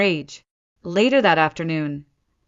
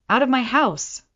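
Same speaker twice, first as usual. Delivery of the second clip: rising, higher angry order to leave; 7 semitones higher, 3 dB louder than usual. A narrator's reading. Nora orders her parents out, voice rising. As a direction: throw them out, voice climbing. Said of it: "my voice rising".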